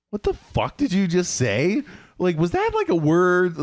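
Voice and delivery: high-pitched, incredulous mock outrage; shocked disbelief. Classmates react to the teacher's odd word, shocked and incredulous. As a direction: react like a whole room aghast, voice shooting up. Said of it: "everybody in the room goes".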